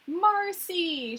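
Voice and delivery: calls her name high; a sharp cry of Marcy. A narrator's reading. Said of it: "she cries".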